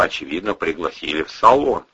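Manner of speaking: ordinary delivery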